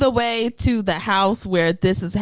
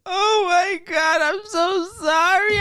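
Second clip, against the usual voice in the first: mock crying apology; 9 semitones higher, faster than usual. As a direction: sob out the apology theatrically. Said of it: "She's like".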